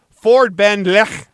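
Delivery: "Ford Ben Lech" slow and loud, with a guttural final ch; careful Welsh pronunciation. Shown as a demonstration. Host reading out a Welsh street name, "Ford Ben Lech" slowly pronounced with throaty ch. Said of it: "I believe that's how they pronounce it".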